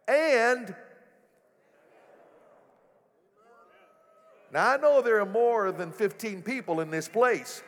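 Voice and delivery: preaching high and loud, building to a shout; full-force preaching. Acted. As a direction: lift the room with a rising proclamation.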